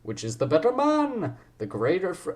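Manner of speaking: high, swooping put-on accent; hammed-up theatrical accent; the Ryan accent. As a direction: declaim in an exaggerated accent, stretching it out. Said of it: "gonna go for the Ryan accent".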